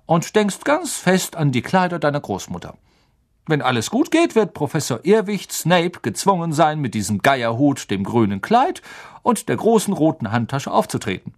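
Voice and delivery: a friendly professor coaxing a shy pupil; Professor Lupin voice. Acted.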